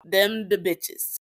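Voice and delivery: slow, heavy stress on "Them the bitches"; slow emphatic. Real, not acted. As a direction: spell out "Them the bitches" slowly, each word hit. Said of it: "I'm telling you now".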